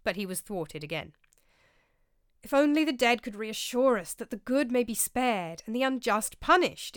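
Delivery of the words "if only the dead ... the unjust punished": high, loud fervent exclamation; exclaiming. A narrator's reading. Brother Lawrence to his brothers, exclaiming fervently. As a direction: cry out in longing. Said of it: "exclaimed Brother Lawrence".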